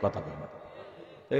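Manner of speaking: unvoiced low whisper of কথা বলেন. Real, not acted. Preacher whispering to the congregation to answer. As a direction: breathe it to the crowd.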